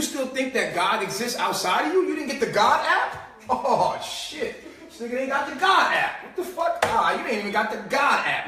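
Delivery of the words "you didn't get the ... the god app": higher, laughing, incredulous; laughing mockery. Mocking someone without the God app, laughing. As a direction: laugh at them in mock disbelief.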